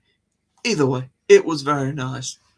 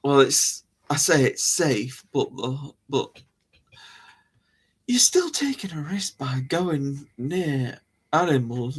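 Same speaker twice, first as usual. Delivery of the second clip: normal talking voice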